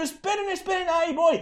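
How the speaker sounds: high-pitched, breathless-to-join-in fish cry; last fish shouting. Pretend. Preacher voices last fish leaping for the net, high eager shout. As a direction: call out eagerly, high, not to be left behind. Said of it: "un último pescado gritando".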